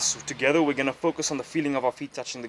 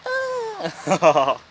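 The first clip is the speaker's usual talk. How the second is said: high burst of laughter; real laughter; 8 semitones higher, 4 dB louder than usual. Real, not acted. Bursts out laughing after noises.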